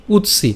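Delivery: উৎসি said as utsi, the ত cut short before সি; Sanskrit pronunciation. Shown as a demonstration. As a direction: clip উৎ then glide into সি. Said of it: "এর উচ্চারণটা কেমন হচ্ছে?". (উৎসি, ত হসন্ত উচ্চারণ)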